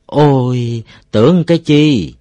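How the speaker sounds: loud, high "is that all" outburst; relieved exclamation. A narrator's reading. Villagers learn the alarm was only silkworms, loud relieved exclamation. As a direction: let the relief out loudly. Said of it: "Mọi người thở phào".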